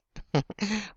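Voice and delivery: short playful laugh; a quick knowing giggle; teasing giggle. Acted. Teasing giggle after innuendo.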